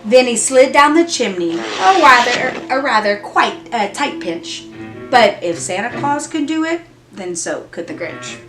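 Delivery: plain reading voice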